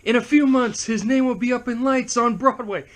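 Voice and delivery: high-pitched showman's proclamation; Robert Armstrong. Retold or imitated. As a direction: quote the famous film line with theatrical relish. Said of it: "I do love how Robert Armstrong, when he says".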